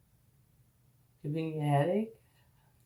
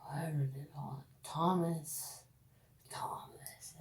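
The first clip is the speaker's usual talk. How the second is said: quick whisper to herself; whispered aside; 2 semitones lower, 7 dB quieter than usual. Real, not acted. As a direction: whisper the name. Aside, trying to recall name.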